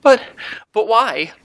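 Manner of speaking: put-on very high, halting child voice; tearful little girl. A narrator's reading. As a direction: falter as a small girl near tears. Tearful girl asks about her mother.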